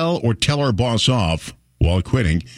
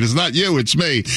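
Costanza breakup line; 7 semitones higher than usual. Retold or imitated. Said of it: "The George Costanza line".

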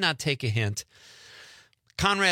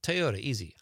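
ordinary delivery

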